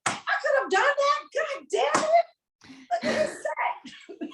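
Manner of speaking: high mock whine, far from her own voice; jealous comic. Comic mocking a jealous audience member, high whiny voice. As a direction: whine it like a bitter comic in the crowd.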